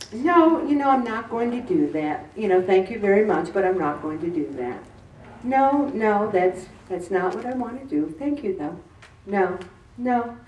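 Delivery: imitating an unruffled coworker; calm refusal. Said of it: "she was so calm".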